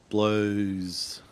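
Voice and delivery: ordinary talk, nothing marked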